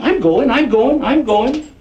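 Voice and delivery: mocking compliance. Gunman mockingly obeying the order.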